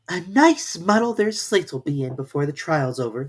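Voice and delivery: put-on a little girl amused at the mess; Alice mocking the jurors' slates; Alice's voice. A narrator's reading. Alice's thought.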